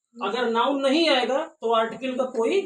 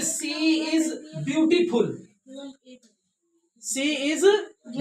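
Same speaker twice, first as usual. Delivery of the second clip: drawn out, word by word; very slow example reading; very slow demo; much slower than usual. Shown as a demonstration. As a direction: read it slowly for the class. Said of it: "जैसे".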